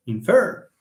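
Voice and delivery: "infer" stressed on FER; teacher's repeated in-FER for "infer"; stress on second syllable. Shown as a demonstration. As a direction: lift the last syllable of "infer". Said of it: "it's infer".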